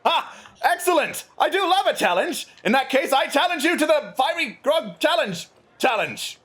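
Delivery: high, thrilled royal challenger; the prince gleefully throwing down a challenge; delighted prince. Acted. GM voices Prince Zordan.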